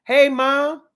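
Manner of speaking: high, loud eager greeting; eager boyfriend voice. Retold or imitated. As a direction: chirp it up to mom. Mocking boyfriend greeting mom.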